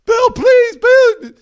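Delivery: very high, loud begging scream; desperate scream. Retold or imitated. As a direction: scream for mercy. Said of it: "brother begging for life".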